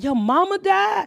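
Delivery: high, drawn-out incredulous question; high-pitched disbelief, imitated; retold disbelief. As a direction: question it in mock astonishment. Retold disbelief at death news.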